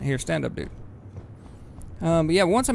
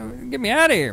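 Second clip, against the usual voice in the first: high, loud cry to be let out; trapped and yelling to get out; panicked shout; 8 semitones higher, 3 dB louder than usual. Real, not acted. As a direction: yell it like you're trapped. Character stuck, panicking.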